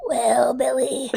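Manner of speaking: slow breathy granny voice; old lady voice. Pretend. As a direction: croak it like an old woman. Playing old-lady character Helen.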